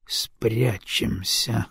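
very slow, quiet musing; drawn out, thinking aloud. Acted. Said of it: "captain repeats, slowly".

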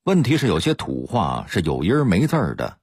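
ordinary talk, nothing marked

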